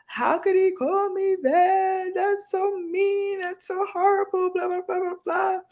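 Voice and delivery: high, wailing mock cry; mock sobbing. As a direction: wail it like a hurt kid. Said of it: "I went home sobbing".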